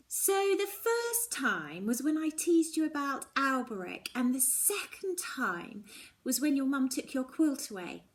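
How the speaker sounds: a curious friend building to a playful dare; Mallory theorising. Acted. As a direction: friend testing the theory by snatching a hat. Mallory reasons, then teases.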